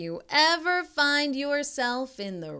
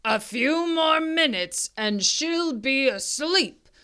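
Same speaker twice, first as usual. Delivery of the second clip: louder, greedy monster voice; hungry dinosaur gloat; 5 dB louder than usual. Acted. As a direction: gloat over the sleeping girl. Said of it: "a dinosaur gloating".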